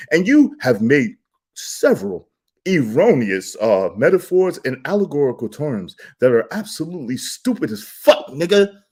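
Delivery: normal talking voice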